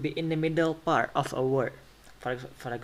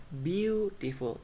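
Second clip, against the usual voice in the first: Beautiful with BEAU high and stretched long; stress on BEAU; 3 semitones higher, slower than usual. Shown as a demonstration. Teacher showing higher and longer stress, BEAU-tiful. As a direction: draw out the first syllable of Beautiful, then drop. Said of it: "louder on it and higher and also longer".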